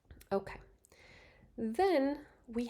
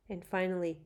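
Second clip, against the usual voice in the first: ordinary talk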